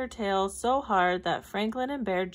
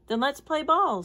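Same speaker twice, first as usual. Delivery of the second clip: high, louder eager call; 7 semitones higher, 6 dB louder than usual. A narrator's reading. Franklin calls to friends in the river, eager and louder. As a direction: call it out bright to win them back.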